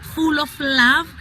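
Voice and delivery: ordinary talk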